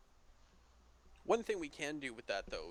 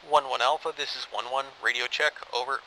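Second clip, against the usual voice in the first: loud, crisp radio call; crackly military radio; 16 dB louder than usual. Shown as a demonstration. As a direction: call it in like over a field radio. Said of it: "I'll ask you over the radio".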